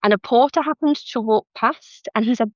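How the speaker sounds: ordinary delivery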